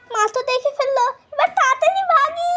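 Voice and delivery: high, loud panicky squeal; panicked. Acted. Daughter panicking as mother catches her at the flowers. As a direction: squeal in alarm and bolt.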